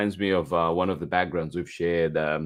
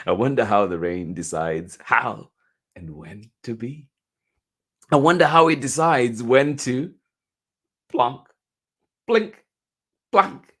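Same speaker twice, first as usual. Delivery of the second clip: loud, musical read with each sound word set apart; wondering story read; 4 semitones higher, 6 dB louder than usual. A narrator's reading. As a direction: wonder aloud, then drop the plinks like raindrops. Reads picture book aloud.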